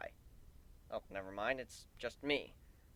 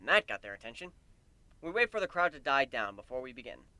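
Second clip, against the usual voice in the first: plain reading voice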